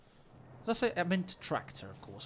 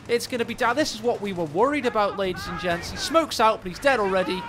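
excited; 5 semitones higher, 8 dB louder than usual. Real, not acted. Casting a tense fight live.

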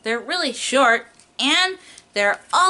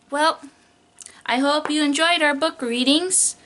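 ordinary talk, nothing marked

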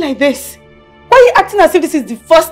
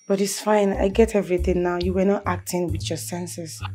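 low, soft reassurance; forgiving; 11 semitones lower, 13 dB quieter than usual. Acted. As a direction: let it go quietly and gently. Wife forgives husband after quarrel.

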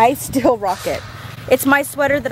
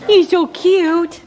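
slow, louder high coo; 5 semitones higher, 4 dB louder, much slower than usual. Real, not acted. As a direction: gush over the horse. Cooing at a horse again.

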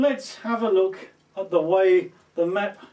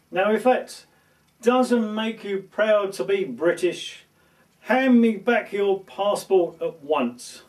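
ordinary talk, nothing marked

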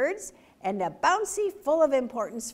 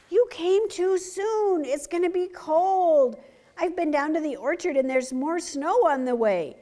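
urgent little fox; 6 semitones higher, 6 dB louder than usual. Acted. Fox warning the birds that cold is coming, urgent and high. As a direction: sound the alarm like an eager young fox.

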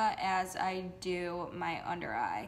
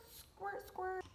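much higher, playful squeak; squeaky high silly squirts; 11 semitones higher, 6 dB quieter than usual. Pretend. Vlogger sprays her face, chirping each squirt in a high voice.

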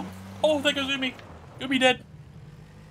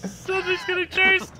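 loud high excited yell; excited shouting; 6 semitones higher, 3 dB louder than usual. Real, not acted. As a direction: shout to your squad. Chased by car in game.

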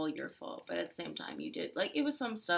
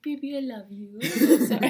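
higher, gushing mock-sweet partner, laughing through it; mock lovey apology; 6 semitones higher, 11 dB louder than usual. Partner mimicking the other's sudden apology after getting mad, mock-sweet. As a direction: imitate a suddenly doting partner, getting louder.

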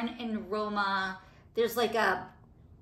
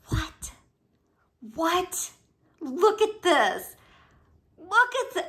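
high, rising gasps of delight at the bottle; genuinely stunned, pitch shooting up; amazed squeal; 8 semitones higher, 4 dB louder, slower than usual. Real, not acted. Vlogger gasping at the bottle she just unboxed, amazed.

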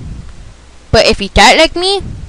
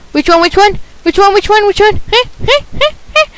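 teasing; 8 semitones higher, faster than usual. Real, not acted. Teasing repeated question.

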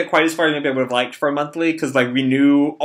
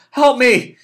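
high, loud cry for help; acted plea for help; 11 semitones higher, 5 dB louder than usual. Boy to Tanaka, plea for help. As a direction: cry out urgently in a raised pitch.

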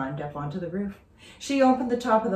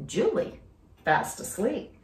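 ordinary delivery, nothing marked

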